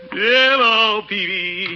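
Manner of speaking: high, booming hello; hearty greeting. Acted. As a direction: sing out a greeting on walking in. Calling greeting on entering store.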